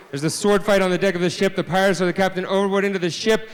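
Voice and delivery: ordinary talk, nothing marked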